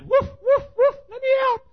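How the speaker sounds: loud, very high yapping woofs. Acted. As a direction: bark frantically like a tiny dog. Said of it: "Chihuahua said".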